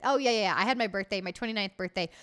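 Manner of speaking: normal talking voice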